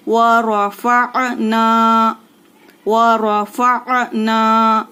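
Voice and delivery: व रफा ना drawn out very slowly, loud and full; slow heavy recitation. Shown as a demonstration. Teacher chanting the word for students, very slowly. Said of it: "इस तरीके से".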